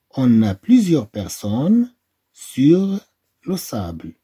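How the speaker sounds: slow, deliberate reading of "plusieurs personnes sur le sable". Shown as a demonstration. Teacher reading a picture caption to pupils, slow. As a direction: read the caption slowly for learners. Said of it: "teacher reading a caption slowly".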